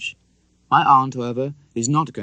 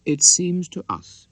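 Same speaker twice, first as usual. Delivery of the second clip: slow solemn aunt; 7 semitones higher, 4 dB louder, slower than usual. A narrator's reading. Aunt speaks with solemn deliberation.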